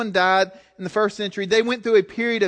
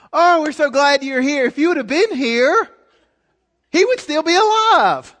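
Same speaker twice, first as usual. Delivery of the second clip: higher, sugary-sweet voice hiding blame; mock-sweet sisters greeting Jesus with a sting; passive aggressive sweetness; 7 semitones higher, 5 dB louder than usual. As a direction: smile while you accuse him. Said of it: "they're doing it with a smile on their face".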